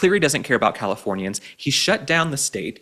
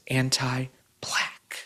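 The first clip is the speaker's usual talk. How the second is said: mock whisper; 6 dB quieter, much slower than usual. Setting up the opponent's clip for viewers, slow mock whisper. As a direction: whisper it slowly like a scandalous secret. Quoting opponent's claim with scorn.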